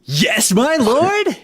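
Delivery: high, loud, eager henchman cry; eager minion. Acted. Henchman to his dark lord, eager high shout. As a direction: snap to attention like a keen underling. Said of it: "play a cereal mascot".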